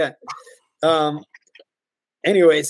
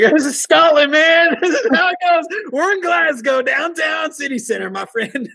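mock worldly shout; 12 semitones higher than usual. Trainer tells the shocked greenie this is Glasgow, high laughing mock shout. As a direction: belt it like a jaded local showing off the chaos.

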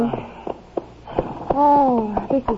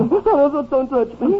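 pained moan; slower than usual.

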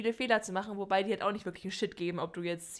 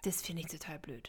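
whispered; 5 semitones lower, 9 dB quieter than usual. Real, not acted. Listener reacting under breath.

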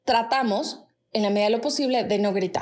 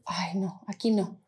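much softer, lower mock kid mutter; hushed; 5 semitones lower, 6 dB quieter, slower than usual. Boys passing the house backing off, hushed. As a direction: drop the voice as if caught near the house. Street kids avoid swearing spot.

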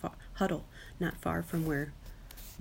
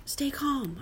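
higher-pitched call to a friend; 9 semitones higher than usual. A narrator's reading. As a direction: call it out high, without shouting. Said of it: "I call".

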